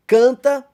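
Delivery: "canta" punched high and loud as a counted beat; counting syllables. Shown as a demonstration. Teacher counting syllables aloud, stressing each beat. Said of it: "você vai contar também sete tempos".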